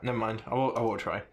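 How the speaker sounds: ordinary delivery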